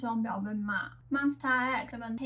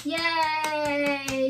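excited; 4 semitones higher, 6 dB louder than usual. Real, not acted. Cheers for the chosen member.

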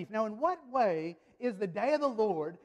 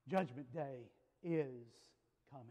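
grave, spaced-out prophecy; solemn warning; 6 semitones lower, 9 dB quieter than usual. Retold or imitated. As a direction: drop down soft and let each word land. Quoting believers' solemn warning.

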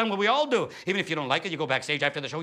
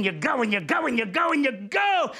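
chugging train shout; 4 semitones higher, slower than usual. Acted. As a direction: push it out like a train building steam. Train rhythm building to shout.

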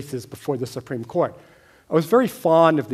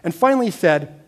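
normal talking voice

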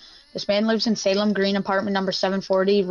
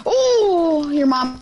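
surprised yell; 7 semitones higher, 4 dB louder, much slower than usual. Real, not acted. Game reaction.

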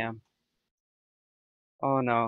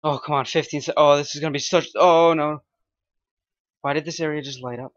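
panicking gamer; 2 semitones higher, 3 dB louder than usual. Real, not acted. Tense countdown, enemies close.

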